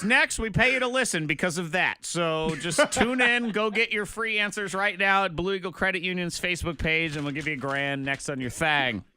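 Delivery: high, loud, upbeat contest plug; punchy radio host promo read. Real, not acted. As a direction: sell the giveaway to listeners.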